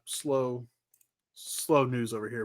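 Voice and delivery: ordinary talk, nothing marked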